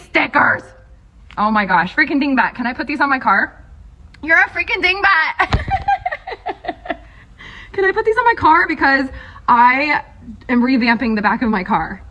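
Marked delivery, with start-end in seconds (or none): 5.7-6.9 airy high giggle; high breathy laugh. Real, not acted. Teasing the artist Dingbat, high breathy giggle.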